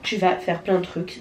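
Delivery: usual speaking voice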